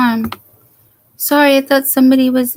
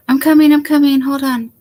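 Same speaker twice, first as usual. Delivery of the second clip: loud, higher call toward the door; 3 semitones higher, 6 dB louder, faster than usual. Real, not acted. Streamer calls to someone at her door, loud call. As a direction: shout it through the house so they hear.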